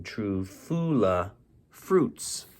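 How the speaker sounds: plain reading voice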